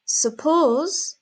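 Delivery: Suppose pitched high, second syllable stretched; stress on Suppose. Shown as a demonstration. Teacher modelling the emphasised word, stress on "Suppose". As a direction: punch the end of "Suppose". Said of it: "my emphasis is on suppose".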